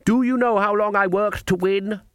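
high-pitched haughty challenge. A narrator's reading. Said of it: "said Missus Goggle haughtily".

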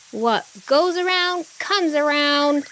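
high, drawn-out far-carrying call; shouted taunt. A narrator's reading. Dragonfly to the monkey king behind her, shouting. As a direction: call it back slowly at a high pitch. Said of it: "and shouted".

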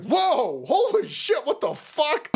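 high, exclaimed imitation of shock; hammed-up startled outburst; mock shock. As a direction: act out startled disbelief, pitch leaping up. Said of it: "He's like".

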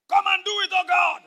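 high, loud cry to heaven; exaggerated shouted plea; mock desperate prayer. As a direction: holler it like an over-fervent prayer. Mimics misguided shouted prayer.

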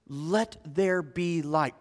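low, soft, slow utterance; imitated God, hushed and unhurried; effortless divine command. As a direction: speak creation into being without effort. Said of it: "He spoke with words".

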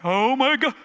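loud, high astonished cry; amazed outcry. Retold or imitated. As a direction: act out her stunned joy at being healed. Acting out her amazed healing reaction.

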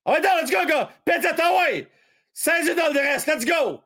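high, cheering shout of joy; goal celebration. Real, not acted. Commentator cheering his team's goal, excited high shout. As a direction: celebrate the goal at the top of your voice.

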